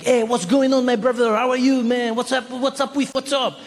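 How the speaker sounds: put-on high, chummy French-accented greeting; cool French guy. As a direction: greet him like a long-lost bro in a French accent. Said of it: "very French, very French, cool guy".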